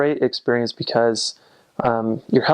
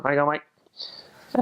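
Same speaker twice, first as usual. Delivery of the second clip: drawn-out Australian greeting, words run together; slow Aussie "how are you going, mate"; Aussie accent; 3 semitones higher, much slower than usual. Shown as a demonstration. Showing how to greet someone, Australian accent. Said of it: "Kinda like one little sound there".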